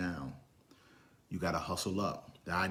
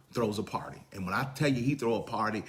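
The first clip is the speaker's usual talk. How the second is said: ordinary delivery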